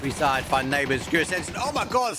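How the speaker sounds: high, loud frantic play-by-play; excited. Real, not acted. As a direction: hype up the fight call. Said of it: "sorry, I'm crazy".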